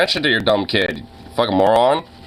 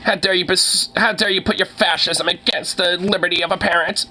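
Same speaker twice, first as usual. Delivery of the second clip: rising higher into a shrill, indignant mock parent; mocking a parent's outrage at a streamer, climbing higher; 9 semitones higher than usual. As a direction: ham up a scandalized parent clutching pearls.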